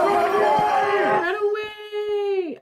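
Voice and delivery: high, long drawn-out "run away" yell; hammed-up cry of retreat; mock fleeing shout. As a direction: holler it out, stretching the words. Sudden mock cry mid-sentence.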